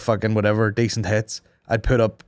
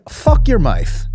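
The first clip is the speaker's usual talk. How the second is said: mock crude snap; 8 semitones higher, 7 dB louder, much slower than usual. Retelling the reply to Amazon, a slow loud mock insult. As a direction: spit the insult out slowly and loud.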